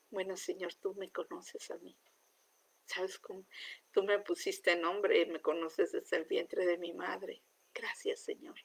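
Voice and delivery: hushed, intimate whisper to God; whispered prayer. Retold or imitated. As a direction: breathe the prayer softly, almost unvoiced. (oración susurrada e íntima)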